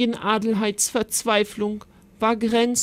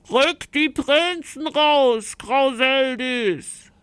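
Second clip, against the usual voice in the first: defiant call; 5 semitones higher, slower than usual. Acted. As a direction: stretch the demand out threateningly. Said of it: "rief die Ritterin".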